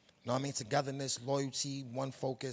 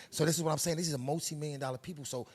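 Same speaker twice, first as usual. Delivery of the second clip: usual speaking voice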